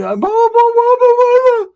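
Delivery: high, loud squeaky blah blah; mocking Ricky's talk. Homeboy mimicking Ricky's chatter in a high loud squeak. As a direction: parody a cousin who won't stop yapping. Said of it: "he talks like that".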